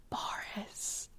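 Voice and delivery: hushed and secretive; conspiratorial whisper; whispered name. Acted. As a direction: breathe the name like forbidden gossip.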